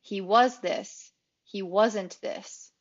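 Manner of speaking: slow, contrasting "was" against "wasn't"; contrast stress. Shown as a demonstration. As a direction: lean hard on "was", then on "wasn't". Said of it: "two contrasting thoughts here".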